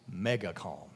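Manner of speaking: ordinary delivery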